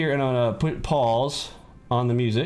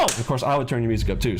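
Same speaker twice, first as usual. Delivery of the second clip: ordinary talk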